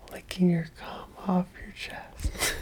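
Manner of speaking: hushed naughty whisper. Real, not acted. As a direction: whisper it like a secret. Whispered confession.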